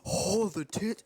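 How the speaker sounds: drawn-out mock admiration; playfully hammed-up leering; mock ogling. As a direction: ogle with exaggerated appreciation. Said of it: "you'll be like".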